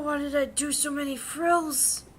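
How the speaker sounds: high, loud, drawn-out whine of despair; mock despairing wail. Real, not acted. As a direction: wail at the frills in half-joking agony. Fussing over painting frills.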